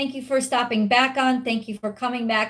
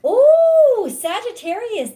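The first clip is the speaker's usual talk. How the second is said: delighted ooh; 12 semitones higher, 7 dB louder, slower than usual. Real, not acted. Reacting to a drawn card.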